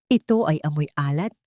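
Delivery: plain reading voice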